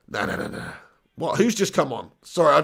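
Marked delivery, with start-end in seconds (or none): 0.1-0.7 whispered dread. Real, not acted. Dreading the substitution.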